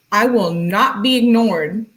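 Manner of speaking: slow, loud, dramatic declaration; hammed-up indignant insistence; mock demand. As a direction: declare it slowly and forcefully. Mock quote of clingy girlfriend.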